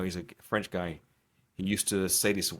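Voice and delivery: usual speaking voice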